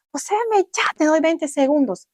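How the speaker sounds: high, angry shouted dare; defiant yell. Retold or imitated. Yelling a challenge at pillow. (reto gritado, agudo y con rabia)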